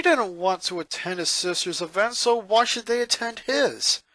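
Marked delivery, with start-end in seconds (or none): none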